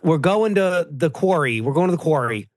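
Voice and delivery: exaggerated Philly accent with heavy vowels; Delco Philadelphia accent. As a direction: parody a TV show's forced Delco accent. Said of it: "so painful how they have to".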